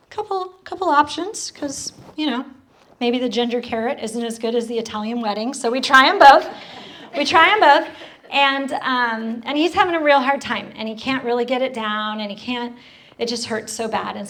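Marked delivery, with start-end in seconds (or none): 5.8-7.9 emphatic. Real, not acted. Emphatic retelling.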